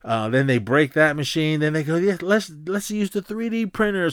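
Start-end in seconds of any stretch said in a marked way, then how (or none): 2.0-4.1 high-pitched eager egging-on; mock eager partier. Partiers after breaking the copier, mock eager suggestion. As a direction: imitate a partier pitching a wild idea. Partiers excitedly pick next stunt.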